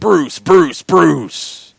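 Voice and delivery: high, loud echoing announcer call; monster truck ad. Retold or imitated. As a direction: yell it like an arena announcer. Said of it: "the monster truck announcer has to do that one".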